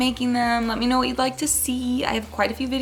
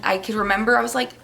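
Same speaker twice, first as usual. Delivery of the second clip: ordinary talk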